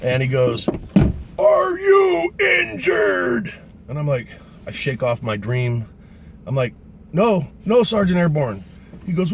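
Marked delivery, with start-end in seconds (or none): 1.4-3.5 high, very slow stretched-out yell; megaphone shout. Retold or imitated. 7.1-8.6 higher, louder trainee answer; raised-voice reply. Retold or imitated. Dazed jumper answering the sergeant, raised voice.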